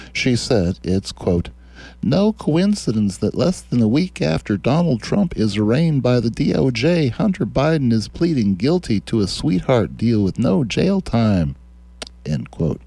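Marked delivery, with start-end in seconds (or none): 2.0-11.6 higher, swooping put-on politician voice; mock senator voice. Host quoting a senator's complaint, in a high put-on voice. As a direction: quote the senator in a raised, mocking lilt.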